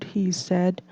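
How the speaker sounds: usual speaking voice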